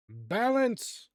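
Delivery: loud, high announcer shout; calling out. Acted. Game master starts the first section, calling out loudly. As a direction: proclaim it like a ringmaster. GM calls out the trial.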